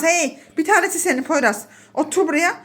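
ordinary delivery

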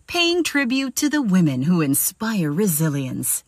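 solemn TV spot announcer; tribute promo read. Shown as a demonstration. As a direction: honor them with weight. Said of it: "it's gonna sound a little bit like this".

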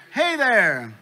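high, loud, falling hail; calling out greeting. Acted. Alien dad hails silent human again, calling out. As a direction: holler hello to a stranger.

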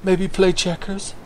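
lightly voiced hopeful old geezer; lonely old man. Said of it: "He's like".

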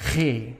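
G as a strong throaty rasp, exaggerated; exaggerated Dutch G. Shown as a demonstration. Teacher exaggerates the Netherlands G for students. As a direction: push the G hard from the throat. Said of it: "I exaggerate now a little bit".

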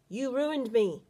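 hint of a Russian gunman yelling, Russian accent; heavy Russian accent. A narrator's reading. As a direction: accuse him in a mild Russian accent. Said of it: "he shouted in a heavy Russian accent".

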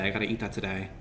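usual speaking voice

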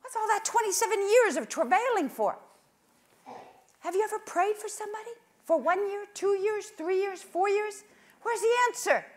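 high, loud pleading cry; impassioned cry. Real, not acted. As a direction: cry out in frustration. Preacher crying out to God.

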